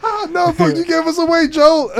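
high, loud comic dismay; mock panic. Companion scolding Joel for giving them away, mock panic. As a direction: cry out in hammed-up alarm. Acted infected spotlight scene in game.

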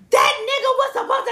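high, indignant quoting. Retold or imitated. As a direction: mimic her outrage. Quoting the angry woman.

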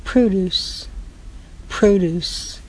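produce stressed on PRO with a long O; produce, first syllable stressed; noun produce. Shown as a demonstration. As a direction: say produce as PROH-dooce, like vegetables. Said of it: "That's like lettuce".

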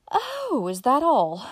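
high, loud relieved exclamation. Acted. Uncle Bobby relieved it's only money, glad exclamation. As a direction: burst out in relief. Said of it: "with a sigh of relief".

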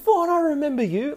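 high, welcoming sing-song greeting; lightly voiced older lady, warmly remembering; welcoming teacher. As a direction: greet him like a delighted old teacher. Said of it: "she was so welcoming, the tone of her voice".

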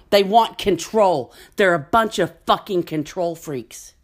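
loud, angry tirade; furious rant. Real, not acted. Vlogger yells in fury at gun-toting patriots. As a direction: shout it out in outrage.

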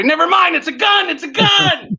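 sudden high-pitched alarmed yelp; mock panic. Flirting comic realises it's a real gun, mock panic. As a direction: back off fast, crying out high.

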